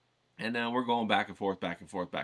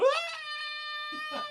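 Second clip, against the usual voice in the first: imitated kid's bawl, shooting up very high; bawling wail. Punched boy starting to cry, one very high bawling wail. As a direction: let out a child's sudden high wail. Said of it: "he bawls out".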